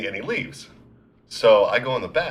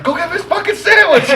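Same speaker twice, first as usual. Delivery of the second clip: high, loud shrieked order; imitated angry yell; 7 dB louder than usual. Store girl ordering kid back, high mock yell.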